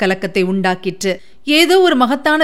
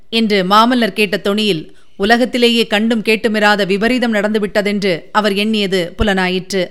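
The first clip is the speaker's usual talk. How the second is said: ordinary delivery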